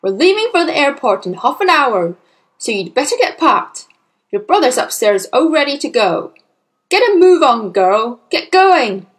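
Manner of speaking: loud, impatient orders; brusque, building to forceful commands; hurrying father. Acted. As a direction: bark at a child to get moving. Harried father hurrying his daughter.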